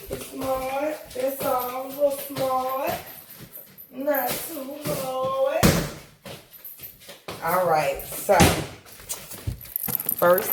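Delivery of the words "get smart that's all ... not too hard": playful high singsong voice; high sing-song. Pretend.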